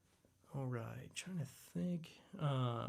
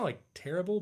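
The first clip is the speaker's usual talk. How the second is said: ordinary talk, nothing marked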